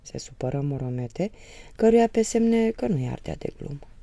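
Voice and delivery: usual speaking voice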